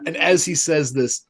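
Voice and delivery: ordinary talk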